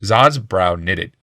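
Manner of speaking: usual speaking voice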